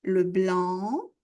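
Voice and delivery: slow, careful teacher repeat of le noir. Shown as a demonstration. Teacher to students, slow careful repeat. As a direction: spell it out slowly for students. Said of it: "Encore une fois".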